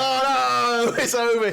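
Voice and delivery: high, hammed-up lament; mock despair. Defeated orc at the final battle, wailing in mock despair. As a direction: wail it high as a beaten villain.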